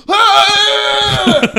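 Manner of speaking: high, loud charging scream; soldier's high war cry while charging the enemy; battle scream. Retold or imitated. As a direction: yell it like charging with a bayonet. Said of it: "I'll scream".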